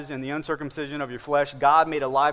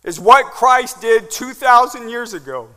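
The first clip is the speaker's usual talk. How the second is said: voice of doubt; 8 semitones higher, 9 dB louder than usual. Acted. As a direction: let the doubt rise and plead. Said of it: "a tension that wells up ... that says".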